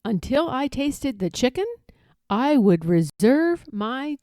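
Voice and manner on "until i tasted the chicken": plain reading voice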